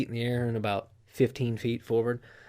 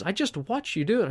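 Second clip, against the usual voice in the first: higher exasperated cop; mock cop voice; 8 semitones higher than usual. Cop calling out the lie, higher exasperated voice. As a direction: play the cop, not buying it. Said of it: "He goes".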